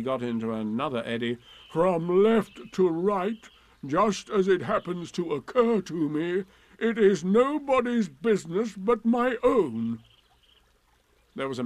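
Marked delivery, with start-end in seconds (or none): 1.7-10.0 loud, raised, slow sarcasm; big scornful donkey voice; sarcastic Eeyore. Acted. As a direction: sound heavily put-upon and ironic.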